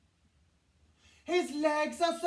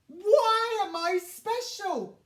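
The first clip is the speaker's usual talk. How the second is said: tearful; 8 semitones higher, 8 dB louder than usual. A narrator's reading. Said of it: "he says with a tear".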